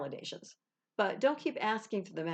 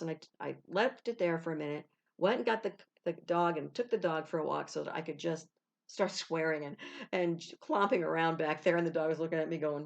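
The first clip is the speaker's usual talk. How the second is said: ordinary talk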